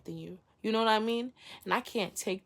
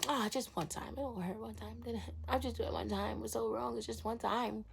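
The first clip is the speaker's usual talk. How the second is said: quiet, dismissive little self-justifying voice; offhand self-excuse; 2 semitones higher, 6 dB quieter than usual. Acted. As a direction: softly brush it off. Acting out excuse to oneself.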